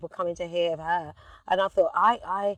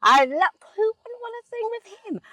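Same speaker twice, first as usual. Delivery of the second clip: much higher, starstruck; gushing. Real, not acted. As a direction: swoon over him. Gushing about [name].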